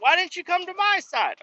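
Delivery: very high put-on voice; imitated complaining coworker. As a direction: play her whining at him. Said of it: "I hear her in there screaming and yelling".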